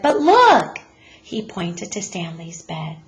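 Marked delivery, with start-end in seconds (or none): 0.0-0.8 higher and louder, urging them to look; a child who can't wait to show them; eager boy. A narrator's reading. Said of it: "Arthur said".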